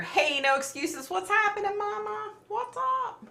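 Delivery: very high-pitched, squealing hello; playful hyped greeting. Real, not acted. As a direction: greet a late friend in a silly high voice. Playfully calling out to a viewer joining.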